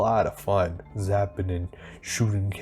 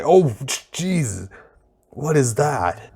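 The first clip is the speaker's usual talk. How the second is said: high, startled outburst at something ugly; genuinely taken aback, voice jumping up; shocked surprise; 5 semitones higher, 4 dB louder than usual. Real, not acted. Sudden shock at redesigned characters.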